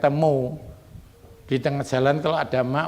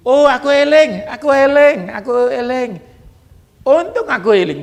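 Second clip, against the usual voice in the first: much higher, louder relieved cry; hammed-up relief, pitched way up; relieved exclamation; 10 semitones higher, 9 dB louder than usual. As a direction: exclaim it like a sudden rescue. Acting relieved person remembering.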